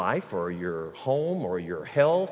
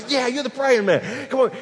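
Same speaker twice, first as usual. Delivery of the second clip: laughing tease; 10 semitones higher, 6 dB louder than usual. Retold or imitated. Waitress teasing the regular, high and laughing. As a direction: chuckle it out, teasing him.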